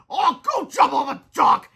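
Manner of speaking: slow, high, loud defiant retort. A narrator's reading. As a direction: throw it back defiantly. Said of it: "said Captain Underpants defiantly".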